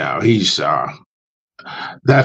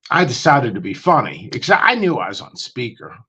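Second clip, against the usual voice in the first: normal talking voice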